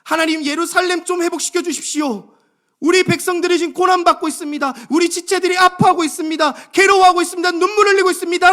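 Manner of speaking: loud, high, urgent plea to God, building to a cry. Acted. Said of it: "상황부터 호소하지 않는 거예요". (점점 고조되며 크게 부르짖는 호소)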